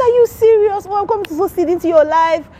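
amazed. Retold or imitated. Woman hearing her children's names, high amazed cry. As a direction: leap up in delighted shock.

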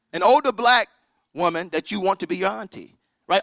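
ordinary delivery

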